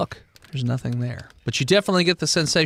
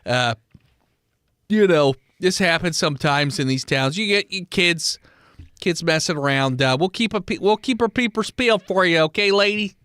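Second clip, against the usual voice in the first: put-on Midwestern accent. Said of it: "You were doing Midwestern".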